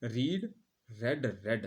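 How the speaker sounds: रीड with a long ee, then रेड with a short e; रीड as "reed", रेड as "red"; read then red. Shown as a demonstration. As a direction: contrast रीड present with रेड past. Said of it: "लेकिन इनको पढ़ते कैसे हैं".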